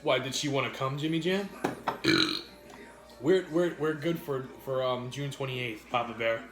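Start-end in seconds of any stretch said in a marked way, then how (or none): none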